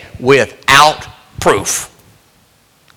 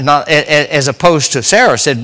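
ordinary delivery

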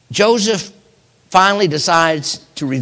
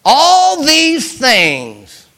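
anguished lament; 11 semitones higher, 7 dB louder, much slower than usual. Acted. Jacob laments his losses.

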